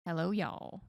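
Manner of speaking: usual speaking voice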